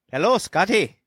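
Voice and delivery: put-on Scottish accent, pitched high; mock Scottish brogue, playfully high; Ewan McGregor. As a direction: greet him in a hammed-up Scottish accent. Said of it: "a perfect impression".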